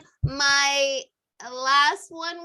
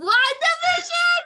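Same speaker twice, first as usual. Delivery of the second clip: surprised; 14 semitones higher, 6 dB louder, slower than usual. Real, not acted. Shocked at celebrity pick.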